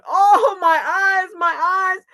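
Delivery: high, loud wail of pain; pained cry. A narrator's reading. Raccoon's eyes burnt by the fire, wailing. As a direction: cry out in acted agony.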